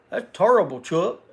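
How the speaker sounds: lightly voiced TV basketball pundit; mild impression of a pundit scolding Chuck; Charles Barkley. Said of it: "That sounds like Charles Barkley".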